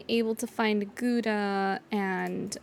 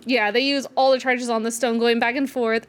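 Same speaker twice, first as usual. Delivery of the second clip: ordinary talk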